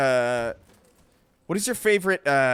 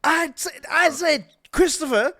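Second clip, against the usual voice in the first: mock posh gentleman; 7 semitones higher, 4 dB louder than usual. Posh voice to Christopher.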